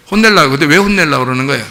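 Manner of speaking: usual speaking voice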